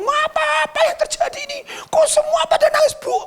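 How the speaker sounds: very high, agitated headmaster voice; alarmed headmaster. Retold or imitated. Headmaster rushing out asking why everyone cries, high and panicked.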